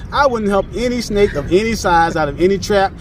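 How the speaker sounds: ordinary delivery, nothing marked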